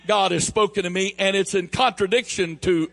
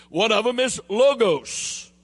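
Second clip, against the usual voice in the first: ordinary talk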